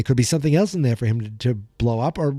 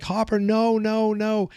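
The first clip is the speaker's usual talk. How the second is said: higher, pleading no no no; a mild echo of a girl begging him to stop; lightly voiced frantic protest; imitating a desperate plea; 7 semitones higher, slower than usual. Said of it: "She's like".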